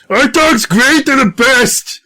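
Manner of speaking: high, excited character voice. Acted. [name] telling Sapala about dogs, high excited voice. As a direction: gush about the dog. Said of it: "and I go".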